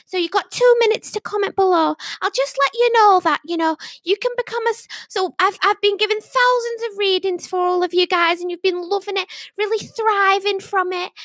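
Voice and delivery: high, sing-out Yorkshire sales patter; Yorkshire psychic impression. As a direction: pitch to livestream viewers in a put-on Yorkshire accent. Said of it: "Yorkshire accent".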